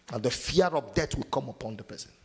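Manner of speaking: ordinary talk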